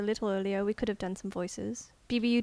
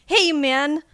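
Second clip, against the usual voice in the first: higher, louder hey man; loud call; 6 semitones higher, 11 dB louder than usual. Shown as a demonstration. Auditioning actor calls out loudly to a friend. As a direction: call out to a buddy. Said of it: "say it like you're really saying it".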